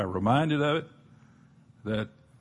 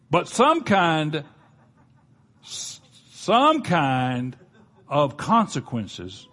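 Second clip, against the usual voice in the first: slow emphasis; 3 semitones higher, 4 dB louder, much slower than usual. Real, not acted.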